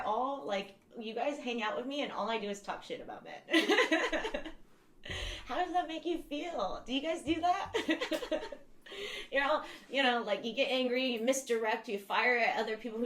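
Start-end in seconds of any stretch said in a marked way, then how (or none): 3.7-4.6 bright high burst of laughter. Real, not acted. Laughing at her own men-bashing joke, high laugh. 7.8-9.3 long high giggle. Real, not acted. Teasing the audience, long high giggle. Laughs after teasing question.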